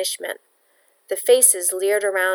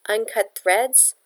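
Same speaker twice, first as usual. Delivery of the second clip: ordinary delivery, nothing marked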